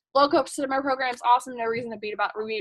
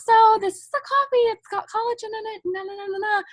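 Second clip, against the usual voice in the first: high, put-on presenter voice; high-pitched mock pitch trailing into na-na filler; mock sales pitch; 8 semitones higher than usual. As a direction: parody a scripted product spiel in a high voice. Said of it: "I'm not being like".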